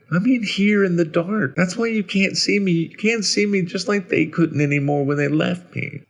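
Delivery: put-on high-pitched child explaining; high, childlike, timid voice; timid little boy. A narrator's reading. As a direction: explain like a small, hurt boy. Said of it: "he timidly explained".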